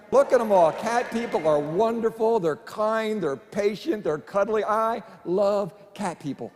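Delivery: ordinary delivery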